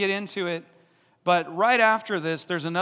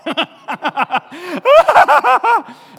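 big high burst of laughter; a loud real laugh at the disciples; 12 semitones higher, 15 dB louder than usual. Real, not acted.

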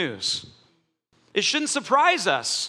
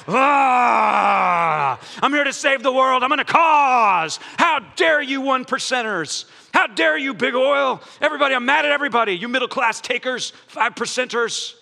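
mock ranting fretter; 4 dB louder than usual. Preacher acting out a fretting activist, hammed-up shouting rant. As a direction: rant like a self-righteous crusader mad at everybody. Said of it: "a biblical rendition of what fretting looks like".

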